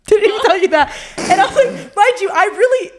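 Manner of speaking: laughing. Real, not acted. Confessing with a laugh.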